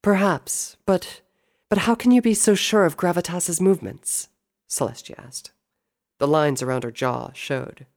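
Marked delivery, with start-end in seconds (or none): 0.0-4.3 high, pressing sister voice; hesitant start, then a high, insistent query; doubting princess. Acted. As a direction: question him with raised pitch. Celestia questions her brother.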